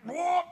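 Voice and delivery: high, loud, short cry; reenacted heedless yell; imitated outburst. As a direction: blurt it out mid-leap. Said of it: "He's like".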